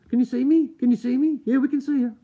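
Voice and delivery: loud, high-pitched mock back-and-forth; mock exchange. Streamer acting out being seen on video, high mock exchange. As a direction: pitch it up high and push it, playing both sides.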